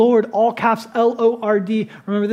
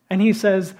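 ordinary delivery

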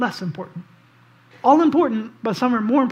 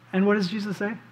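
ordinary talk, nothing marked

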